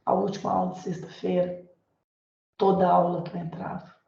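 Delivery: ordinary talk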